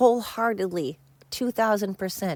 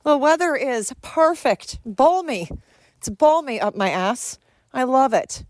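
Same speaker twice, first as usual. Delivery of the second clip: high, airy mock-cheerful celebrity voice, much higher; ditzy celebrity; 6 semitones higher, 3 dB louder than usual. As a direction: play a smug oblivious star bragging in a breezy high voice. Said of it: "just like".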